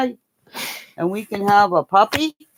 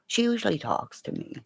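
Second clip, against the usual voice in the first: dog's inner voice; 10 dB quieter than usual. Pretend. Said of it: "Diesel's going".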